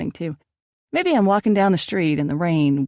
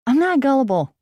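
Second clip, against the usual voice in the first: higher, louder indignant protest; defensive; 9 semitones higher, 5 dB louder than usual. Acted. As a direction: push back hard against the accusation. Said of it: "I said defensively".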